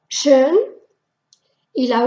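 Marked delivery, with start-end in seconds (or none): 0.1-0.6 schön with rounded lips; schön, rounded E sound; German ö. Shown as a demonstration. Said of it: "La O". (la ö de schön)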